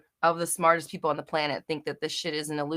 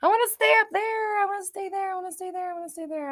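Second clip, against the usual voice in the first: mock whine; 12 semitones higher, faster than usual. Mimicking people who wanna stay up there, mock whine. Mimics a whining complaint after "I'm like.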